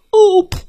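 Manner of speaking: loud high oof as he lands; loud thud grunt. Acted. Bear tumbling into the basket, loud oof. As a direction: grunt as you crash down.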